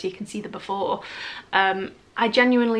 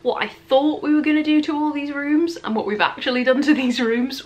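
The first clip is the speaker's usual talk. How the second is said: ordinary talk, nothing marked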